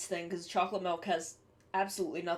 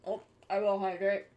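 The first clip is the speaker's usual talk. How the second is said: talking with mouth full of chicken. Real, not acted. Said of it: "I have chicken in my mouth".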